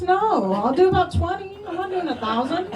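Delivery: comic refusal. Retold or imitated. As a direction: refuse the friends' idea with playful indignation. Her comic refusal, retold.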